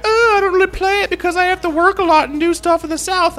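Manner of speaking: high-pitched whiny mock excuse; mocking imitation. Host mimicking Pauline's excuse for not playing, high mocking voice. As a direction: mimic the caller in a silly high voice.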